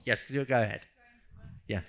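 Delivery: ordinary talk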